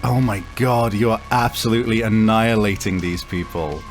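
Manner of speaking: normal talking voice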